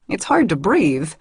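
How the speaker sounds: ordinary delivery, nothing marked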